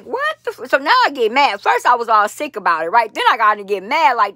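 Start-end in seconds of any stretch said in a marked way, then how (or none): 0.0-0.7 cut-off curse, flung very high; outraged disbelief. Retold or imitated. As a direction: leap up high, too stunned to finish. Realizing she paid for nothing.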